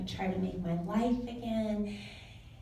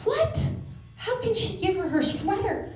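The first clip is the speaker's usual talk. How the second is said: high-pitched outraged schoolkid, re-enacted; indignant; 11 semitones higher than usual. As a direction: burst out in jealous disbelief, pitch way up. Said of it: "I was so mad".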